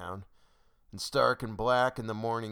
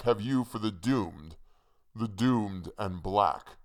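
put-on voice, slowing on the last phrase; lightly voiced hangman's retort; scornful hangman. A narrator's reading. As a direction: draw out the end with scorn.

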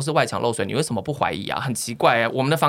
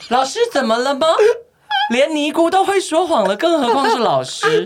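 high, slow, sarcastic jab; mocking sarcasm; 6 dB louder than usual. Mocking the teacher's honesty, slow high sarcasm. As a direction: drawl the mockery, slow.

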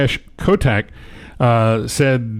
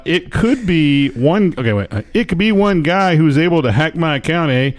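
reading the news in a hammed-up Canadian accent; mock Canadian accent; 3 semitones higher than usual. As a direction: tack a Canadian eh onto every line. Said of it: "So he got a speech impediment?".

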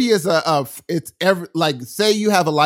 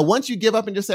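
ordinary talk, nothing marked

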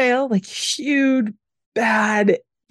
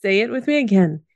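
ordinary talk, nothing marked

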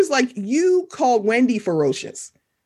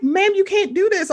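scolding ma'am; 9 semitones higher than usual. Retold or imitated. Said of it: "It was just like".

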